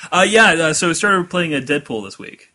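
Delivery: usual speaking voice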